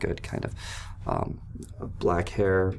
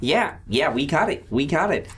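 raised, chipper friendly voice; Zanzer voice; 8 semitones higher than usual. Acted. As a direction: keep it high and eager. GM continues Zanzer's voice.

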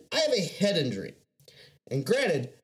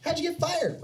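curious coworker. Retold or imitated. Coworkers asking him.

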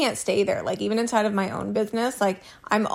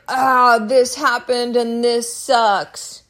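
slow, drawn-out whiny complaint, a bit louder; mock venting complaint; 2 semitones higher, 6 dB louder, much slower than usual. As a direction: dump the problem like a helpless moan. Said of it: "I don't just get in there and be like".

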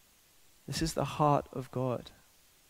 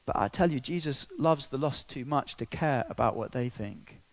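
ordinary talk

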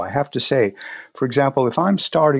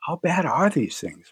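higher, anxious imitated patient asking; worried patient; 6 semitones higher than usual. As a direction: play the nervous patient, voice up. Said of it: "They're going".

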